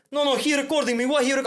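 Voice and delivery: put-on agitated, high Armenian-accented voice; imitated Armenian man. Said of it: "he was like some Armenian guy".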